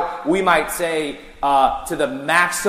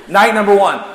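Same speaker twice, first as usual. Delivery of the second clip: laughing through the words, higher and louder; 7 semitones higher, 6 dB louder than usual. Real, not acted. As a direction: burst out laughing at the memory. Laughing at bear story.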